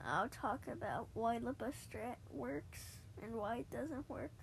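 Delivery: ordinary talk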